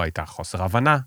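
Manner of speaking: normal talking voice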